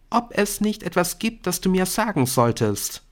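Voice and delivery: put-on high-pitched, prompting girl's voice; expectant schoolgirl. A narrator's reading. Brynn fishes for words from Nick, high and expectant.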